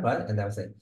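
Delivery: normal talking voice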